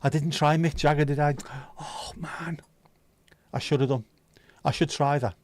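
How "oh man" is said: whispered sigh of regret; hushed regret. Real, not acted. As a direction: say it under your breath, much softer. Regretting a missed impression.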